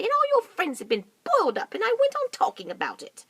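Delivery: very high indignant protest; roly-poly bird rebuking Mugglewump; offended bird. Acted. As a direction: bristle at him.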